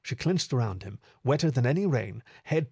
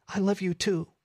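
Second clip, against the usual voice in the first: high, tender female character voice; Meadow, tender; 8 semitones higher than usual. Acted. Meadow returns Fib's declaration of love, tender. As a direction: answer lovingly in a lifted voice.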